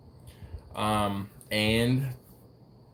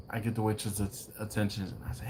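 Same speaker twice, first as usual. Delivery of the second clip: normal talking voice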